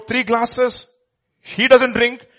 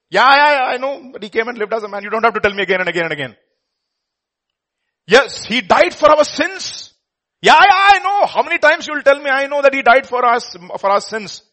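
louder, higher exasperated brush-off; impatient; 5 semitones higher, 7 dB louder than usual. Retold or imitated. Drunk man fed up with Richard repeating the creed, exasperated. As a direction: wave it off, fed up.

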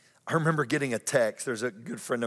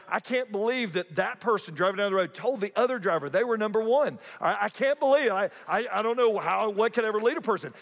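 mock indignation; 7 semitones higher than usual. Pastor mimicking his judgmental self to the congregation, mock outrage. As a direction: play up the self-righteous disbelief.

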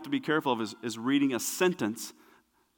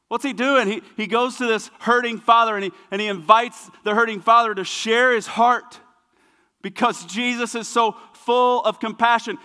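raised, driving sermon delivery; impassioned preaching; 7 semitones higher, 6 dB louder than usual. Real, not acted. Preacher on Jesus' compassion, impassioned and driving. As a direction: preach it with rising urgency.

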